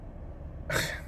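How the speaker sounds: a long breathy sigh out; heavy sigh. Real, not acted. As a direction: let the weight out in one breath. Pause before naming suicide.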